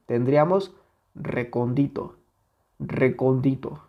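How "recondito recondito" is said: recóndito misstressed as recon-DI-to; stress removed. Shown as a demonstration. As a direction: move the weight off CON onto DI in recóndito. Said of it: "Si nosotros le quitamos esa intensidad". (recóndito sin intensidad en CON, como recondíto)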